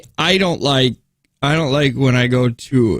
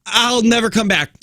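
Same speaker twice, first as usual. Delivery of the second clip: higher, joking parting line; mock farewell; 7 semitones higher than usual. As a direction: toss it off as a joke. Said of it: "I say".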